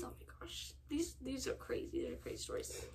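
hushed whisper, almost no voice. Real, not acted. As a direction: whisper it like a secret aside. Aside while reading the confessions.

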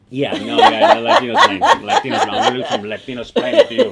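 loud, high, laughing through the words; cracking up mid-joke. Real, not acted. Joking with the host.

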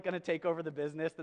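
ordinary talk, nothing marked

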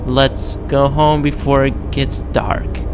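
slow, drawn-out spooky storyteller voice; spooky nursery-rhyme narration, slow; dramatic story reading. Acted. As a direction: read it like a creepy bedtime rhyme. Reads eerie rhyme aloud slowly.

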